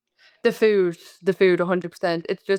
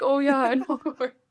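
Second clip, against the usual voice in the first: higher, knowing reply with a laugh; Scottish professor recognizing Cork, lightly higher with a laugh; imitating professor; 6 semitones higher than usual. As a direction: play the professor's amused recognition. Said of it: "He was like".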